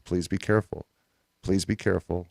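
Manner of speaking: low, soft, distant ghostly warning; haunting voice. Retold or imitated. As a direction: breathe it out like a faraway ghost. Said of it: "the ghostly voice simply repeated its cryptic warning".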